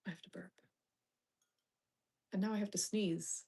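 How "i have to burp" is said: breathy whisper to chat; hushed aside before a burp. Real, not acted. Streamer whispering to chat before a burp.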